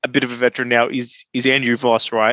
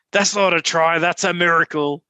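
higher-pitched rugby league commentator impression; raised, declaring sports-caller voice; Ray Warren; 4 semitones higher than usual. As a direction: call it like a veteran match commentator. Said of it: "an impression of him vocally".

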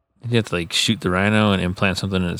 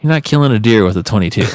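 mock disbelief; 7 semitones higher than usual. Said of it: "It's like".

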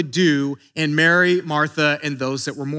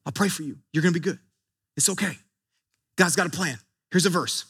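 dismissive comforting; 4 semitones lower, 5 dB quieter, faster than usual. Retold or imitated. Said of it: "just be like".

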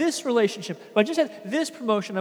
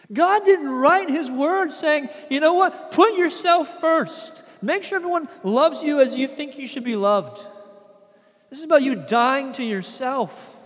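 forceful preaching; 3 semitones higher, 6 dB louder than usual. Acted. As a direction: drive the point home from the pulpit.

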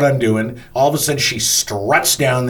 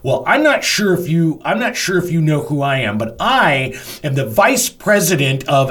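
self-important woman, higher-pitched; mock attitude; 6 semitones higher than usual. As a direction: imitate someone pulling rank with attitude. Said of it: "gets the attitude".

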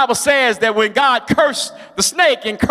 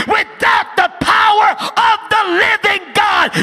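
preaching at full force; 9 semitones higher than usual. Acted. Preacher urging believers never to fear death, full-force preaching. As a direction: thunder it out so nobody walks in fear.

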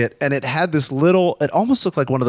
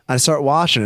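normal talking voice

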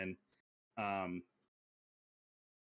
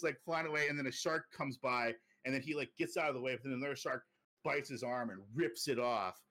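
ordinary talk

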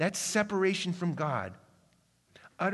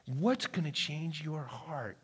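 quiet question; 2 semitones lower, 7 dB quieter, slower than usual. Real, not acted. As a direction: ask it softly and slowly, almost to yourself. Preacher asks quietly, slowly.

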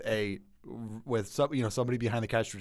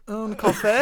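mock French; 9 semitones higher, 6 dB louder, slower than usual. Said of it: "I'd be like".

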